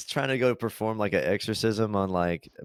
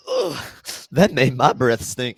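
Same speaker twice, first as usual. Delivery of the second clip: disgusted priest quote; 4 semitones higher, 4 dB louder than usual. Retold or imitated. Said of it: "And he's like".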